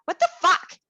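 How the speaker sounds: high, loud What the fuck with a laugh in it; mock outraged shout. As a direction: blurt it out, half laughing. Blunt call-out between close friends.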